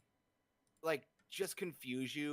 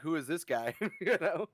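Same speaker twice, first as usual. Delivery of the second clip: laughing; 5 semitones higher, 7 dB louder than usual. Retold or imitated. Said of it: "it's like".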